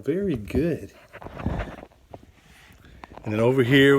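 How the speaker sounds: normal talking voice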